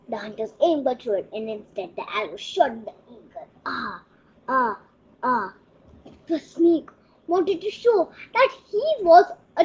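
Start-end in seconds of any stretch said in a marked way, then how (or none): none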